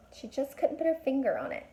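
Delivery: usual speaking voice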